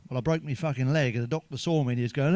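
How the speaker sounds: ordinary talk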